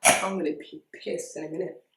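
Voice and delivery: ordinary talk, nothing marked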